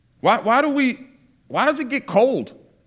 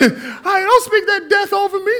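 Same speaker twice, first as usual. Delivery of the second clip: high, louder mock-horrified laugh through the words; mock horror through laughter; shocked laughing; 13 semitones higher, 4 dB louder than usual.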